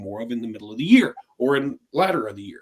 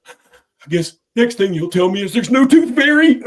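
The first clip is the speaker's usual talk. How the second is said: higher, louder, slow put-on kid voice; little brother voice; 6 semitones higher, 8 dB louder than usual. Little brother asking his mom in the car, in a higher, slower, louder kid voice. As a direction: play the gloomy little brother, drawn out.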